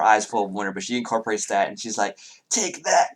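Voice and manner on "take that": put-on high child shout of triumph; a child zapping a monster; kid battle cry. Child acts spell in play.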